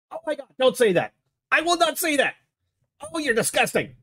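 high, agitated outrage throughout; appalled host berating his co-host; indignant scolding. Acted. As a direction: scold him like a naughty child. Scolding Trent over rude suggestion.

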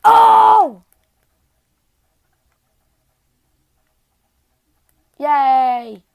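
loud joyful whoop; excited cheer. Real, not acted. Finally hits a shot.

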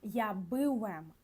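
Polish "я бивем" drawn out slowly, every sound clear; slow Polish demo. Shown as a demonstration. Teacher modelling the Polish form for students, very slowly. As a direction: say "я бивем" slowly for learners to copy. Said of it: "«Би» плюс «вем»".

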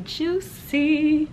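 high, drawn-out JUICY; playful emphasis. Real, not acted. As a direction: stretch "juicy" out high and teasing. Repeats the word for effect.

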